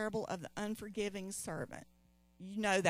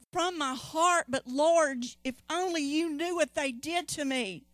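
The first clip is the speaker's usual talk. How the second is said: raised, protesting plea to the Lord; reluctant believer; 6 semitones higher, 4 dB louder than usual. Pretend. A churchgoer objecting to God, higher and louder. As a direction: complain that you were wronged.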